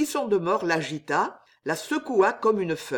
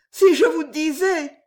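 high, louder entreaty; pleading; 10 semitones higher, 7 dB louder than usual. A narrator's reading. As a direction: beg him to hear you out. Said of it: "dit Jeanne suppliante, les mains jointes".

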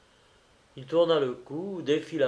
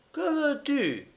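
drawling voice; slower than usual. Acted. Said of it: "d'une voix traînante".

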